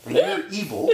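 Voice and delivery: high laughing complaint. Real, not acted. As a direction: laugh through the words, slowly.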